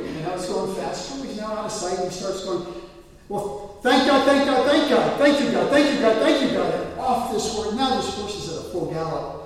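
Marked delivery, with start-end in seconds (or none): 3.3-6.7 loud, high, breathless repeated thanks; frantic excited shouting. Retold or imitated. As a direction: yell it as the horse takes off. Rider urging horse to gallop.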